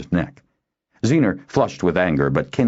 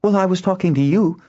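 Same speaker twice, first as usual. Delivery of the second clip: very high, indignant retort; raised, high-pitched snap; snapping back; 4 dB louder than usual. A narrator's reading. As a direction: throw their words back hotly. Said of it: "Nuella snapped back".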